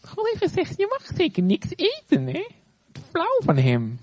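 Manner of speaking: high sly serpent voice; snake voice. Acted. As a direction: tempt her like a sneaky snake. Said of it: "Een beetje als een slang praat".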